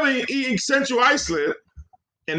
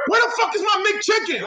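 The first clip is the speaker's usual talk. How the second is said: very high imitation of an irate woman; mock angry demand; 11 semitones higher than usual. Drive-through girl demanding her McChicken mid-order, mock angry. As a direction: squawk it high, hammed-up outrage. Acting out the girl yelling for food.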